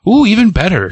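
high, pleased ooh; delighted. Retold or imitated. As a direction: relish it as a compliment.

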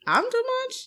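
high, rising disbelieving question; incredulous. Retold or imitated. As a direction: echo the insult back in mock disbelief. Acted disbelief at his remark.

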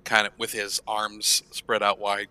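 ordinary delivery

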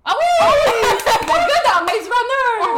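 high, loud shriek of recognition; laughing, high-pitched yell as it finally clicks; excited outburst. Real, not acted. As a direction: shout it out, thrilled and laughing. Suddenly recognizes the actor, excited shouting.

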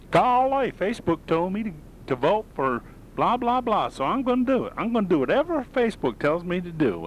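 very high, goofy falsetto; mock gullible voter. Host mimicking voters who obey Facebook, high mocking voice. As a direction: play a naive simpleton in a squeaky mocking voice.

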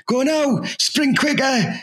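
high, barking mock-coach orders; mock coach shout. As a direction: bark it like a fitness coach at the lads. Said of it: "shouts at the lads".

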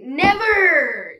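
loud, drawn-out playful refusal; mock defiant cry. Boy refusing dad's call for work, mock defiant. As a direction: stretch it out in showy defiance.

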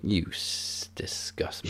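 hushed, breathy mock disgust; disgusted whisper. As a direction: whisper it like you're repulsed. Mocking Daniel Bryan's disgusted look.

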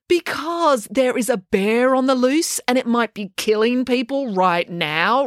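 put-on girl ranting to the boys at dawn, heated. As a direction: rant it, dragging out the last words. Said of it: "ranted April".

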